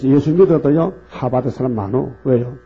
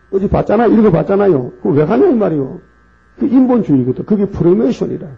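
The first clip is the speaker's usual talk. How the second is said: higher, louder outraged preaching; indignant shout; 6 semitones higher, 4 dB louder than usual. Real, not acted. Preacher crying out at churches visiting the North, outraged. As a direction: cry out against it in outrage.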